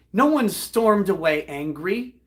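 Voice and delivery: ordinary delivery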